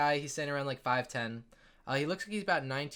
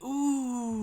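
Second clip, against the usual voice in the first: put-on awed kids; 10 semitones higher than usual. Kids react in awe.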